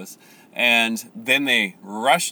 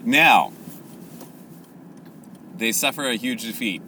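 ordinary talk

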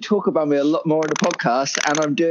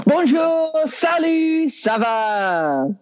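mock French greeting; 10 semitones higher, much slower than usual. Host greets the returning guest.

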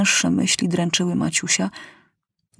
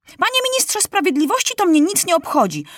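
very high, fed-up protest; young ruler losing patience; exasperated boy king; 11 semitones higher than usual. A narrator's reading. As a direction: interrupt in mounting exasperation, pitch way up. King repeats interruption, more exasperated.

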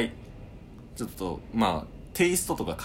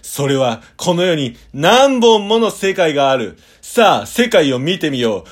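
loud, slow, higher dramatic ad voice; epic commercial announcer; 7 semitones higher, 15 dB louder, slower than usual. Pretend. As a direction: boom it out like an over-the-top trailer. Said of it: "じゃあ行きます".

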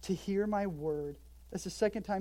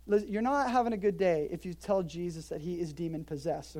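ordinary talk

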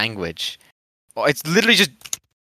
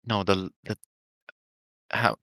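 ordinary talk

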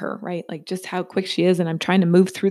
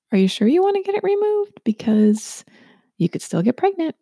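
light, curious, kindly question raised in pitch; curious gentle tone; 9 semitones higher than usual. Retold or imitated. Kind new doctor gently asking the patient, curious and warm. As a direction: ask it with open curiosity, not judgement. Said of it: "The tone in which she said that".

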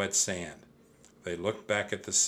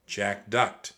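ordinary delivery, nothing marked

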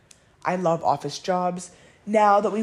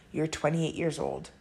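ordinary delivery

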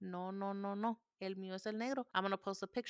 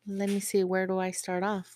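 normal talking voice

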